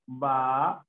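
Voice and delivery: "va" held long, standing alone; drawn-out "va", then stop; syllable by syllable. Shown as a demonstration. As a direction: sound out "va" as one separate syllable. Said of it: "Se separa así".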